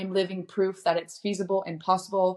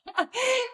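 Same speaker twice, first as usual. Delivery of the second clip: short high-pitched laugh; a quick, high burst of laughter. Real, not acted. Guest amused by the host's question, short high laugh.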